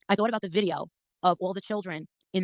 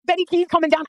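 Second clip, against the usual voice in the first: excited; 9 semitones higher, 8 dB louder than usual. Real, not acted. Recalling scary moment.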